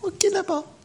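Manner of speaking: a scolded devil giving up; meek cowed devil. Acted. Devil answers preacher's rebuke meekly, high-pitched.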